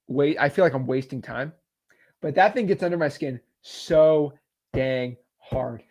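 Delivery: ordinary delivery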